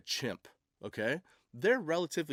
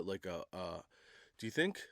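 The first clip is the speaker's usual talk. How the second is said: normal talking voice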